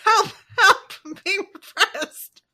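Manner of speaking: high, loud comic protest; hammy strained shouting; mock outraged peasant. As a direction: cry out like an oppressed film peasant. Quoting a film peasant's protest.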